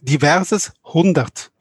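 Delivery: langsam und deutlich wiederholt; slow and clear. Real, not acted. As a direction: jedes Wort für den Moderator klar setzen. (langsam und deutlich)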